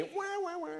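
high mock baby crying "wah"; playful baby-cry imitation; mock baby wail. Baby Benny crying on Mondays.

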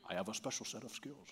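low, quiet menacing movie voice; a hushed, deadpan action-hero threat; Liam Neeson impression. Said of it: "Remember the movie Taken?".

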